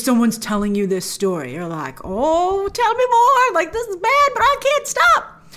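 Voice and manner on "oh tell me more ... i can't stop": high, eager mock plea; hooked on gossip. Viewer begging the storyteller for more, high and eager. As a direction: beg for more like a gossip fan.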